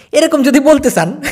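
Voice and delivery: breaks into a high laugh mid-sentence; amused giggle while explaining; laughing at his own joke. Real, not acted. Laughs at viral phrase.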